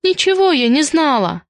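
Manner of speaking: high, whiny denial; plaintive girlish insistence; stubborn denial. A narrator's reading. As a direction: keep denying it in a high voice. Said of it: "продолжала отнекиваться Киска".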